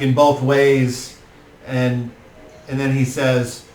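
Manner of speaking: ordinary talk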